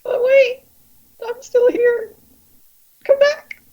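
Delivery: tiny, very high squeak of a voice; poor abandoned Riku. Acted. Riku left alone in the dark calls after captors, high plaintive squeak. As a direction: call out in a comic high falsetto. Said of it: "leaving poor Riku alone in the dark".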